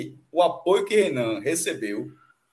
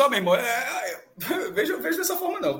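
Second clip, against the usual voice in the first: mock fan voice; 5 semitones higher than usual. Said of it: "a galera disse".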